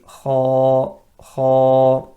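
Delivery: Kha voiced heavy and loud, sound lifting upward. Shown as a demonstration. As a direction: say Kha thick, the sound rising to the palate. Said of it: "the sound goes upwards towards the upper palate".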